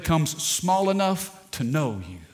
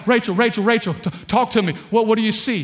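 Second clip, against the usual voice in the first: louder, higher frantic calls for his wife; panicked calling; 5 semitones higher, 5 dB louder, faster than usual. Retold or imitated. Husband running inside to his wife, panicked calling. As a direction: rush in calling her name in a panic. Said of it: "I'm like".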